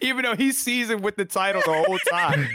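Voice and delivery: high-pitched, laughing as you speak; amused, laughter bubbling through the words; talking through laughter. Real, not acted. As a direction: crack up while getting the words out. Co-host cracking up at the story.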